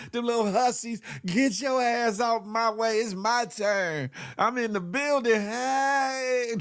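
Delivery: hammed-up excited woman; mock excited shout. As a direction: claim the dance floor. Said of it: "Tina B was like".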